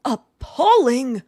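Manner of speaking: slow, booming outraged bellow. A narrator's reading. Colonel Stick-in-the-Mud bellows at the wild garden, slow and loud. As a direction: stretch it out like an outraged old colonel. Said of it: "bellowed Colonel Stick-in-the-Mud".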